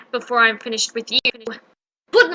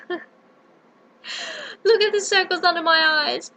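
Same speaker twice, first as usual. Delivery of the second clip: slow, higher, sobbing whine; blubbering; 5 semitones higher, slower than usual. Acted. Lion showing his tired eyes, slow tearful whine. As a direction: wail it through tears.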